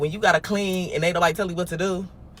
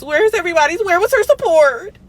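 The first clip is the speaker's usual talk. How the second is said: mock outrage; 12 semitones higher, 7 dB louder than usual. Mock public outcry.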